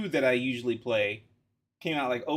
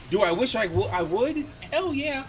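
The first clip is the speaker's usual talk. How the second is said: excited; 10 semitones higher, 5 dB louder than usual. Real, not acted. Admits he wants the game.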